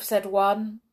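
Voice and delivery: plain reading voice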